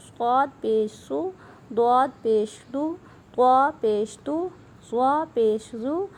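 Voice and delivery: slow, clear syllable-by-syllable reading; recitation drill. Shown as a demonstration. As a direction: read each letter slowly like a teacher. (آہستہ واضح ہجے)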